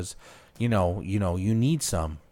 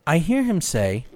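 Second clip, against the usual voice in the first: ordinary talk, nothing marked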